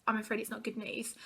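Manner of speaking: soft, grave doctor's voice; really serious. Retold or imitated. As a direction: break bad news very softly. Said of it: "really, really serious".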